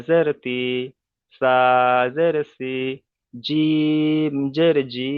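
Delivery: slow measured recitation, বা held long before জের বি; teacher's model chant, দাল stretched, ending on দি; spelling drill. Shown as a demonstration. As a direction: draw out each letter name, then জের and a clear "i" syllable. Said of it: "এরকম ভাবে উচ্চারণ করতে হবে".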